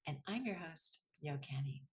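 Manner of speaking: measured, scripted host introduction; podcast intro read. Acted. Host introduces her guest to listeners, scripted intro read. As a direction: read the episode introduction evenly, pausing between phrases.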